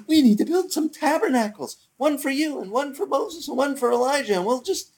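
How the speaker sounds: high-pitched, eager babbling disciple; Peter. Retold or imitated. Preacher imitating disciple proposing tabernacles, mock eager. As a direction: pitch it high, gushing with a building plan. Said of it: "Peter's like".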